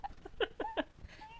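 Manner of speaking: very high squealing laugh; real cracking-up laughter; helpless laugh. Real, not acted. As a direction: burst into high-pitched giggles. Cracks up at the helpful message.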